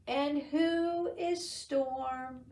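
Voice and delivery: slow, lightly voiced sleepy cat. Just-woken cat asking the animals, slow sleepy meow. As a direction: drawl it like a drowsy cat. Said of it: "Cat meowed".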